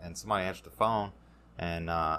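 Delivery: ordinary talk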